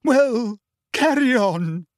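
high, loud, near-hysterical. A narrator's reading. Trelawney snapping at her class, high and loud. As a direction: snap it out at the class, shrill with upset. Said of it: "said Professor Trelawney loudly".

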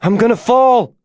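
high-pitched, panicked cry; screamed in fear. A narrator's reading. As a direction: cry out as if about to fall. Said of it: "she screamed".

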